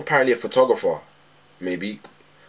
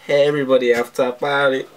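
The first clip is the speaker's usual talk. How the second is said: party call-out; 5 semitones higher, 5 dB louder than usual. Real, not acted. Calling out to the room.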